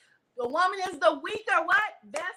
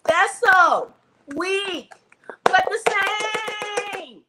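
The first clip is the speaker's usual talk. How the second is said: loud punchy preaching shout; preacher shout; 5 semitones higher, 7 dB louder, slower than usual. Acted. Preacher belting to the congregation, loud shout. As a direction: belt it out to the congregation.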